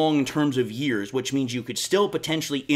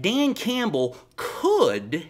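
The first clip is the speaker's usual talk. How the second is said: slow, drawn-out "could", pitched up; stress on could; 4 semitones higher, much slower than usual. Real, not acted. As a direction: lean hard on "could" to sound doubtful. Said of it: "I put heavy emphasis on that word".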